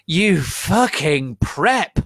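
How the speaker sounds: loud, high, slow furious yell; angry yell. A narrator's reading. Friends yelling at the traitor, furious and slow. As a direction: spit each word out at him. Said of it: "We all yielded, Arngrilly".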